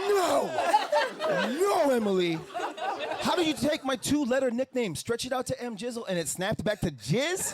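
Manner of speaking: high, loud protesting no's; high loud protest at a coworker's nickname; mock anguished yelling. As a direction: wail in comic despair.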